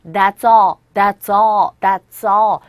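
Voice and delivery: "That's all" run together as "that-saw"; loud drill, the S of "That's" sliding into the vowel of "all"; linked pronunciation. Shown as a demonstration. Teacher drills linking for learners, "That's all" joined as "that-saw". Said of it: "어이라는 모음의 소리가 연결되어져서".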